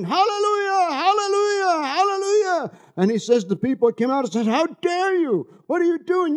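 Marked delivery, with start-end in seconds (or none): 0.0-2.7 imitated shouting. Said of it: "started shouting". 4.5-6.4 high-pitched imitated scolding; mock indignation. Outraged townspeople scold the shouting boy.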